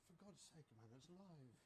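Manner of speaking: hushed exasperation. Acted. Actor reminds colleague it's live, in a hushed exasperated aside. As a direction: scold him under your breath.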